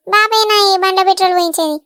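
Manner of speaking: ordinary delivery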